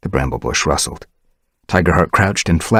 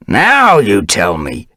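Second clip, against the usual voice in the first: put-on slow, louder old-cat rasp; grumpy elder rasping at Dovepaw; 4 semitones higher, 7 dB louder, slower than usual. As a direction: grumble it out slowly, rasping. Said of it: "he rasped".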